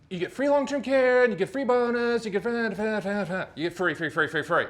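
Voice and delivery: lightly mocked pitchman piling on the freebies; seminar salesperson pitch. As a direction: imitate a seminar seller, starting high and settling down. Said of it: "The person's up there and they'll say".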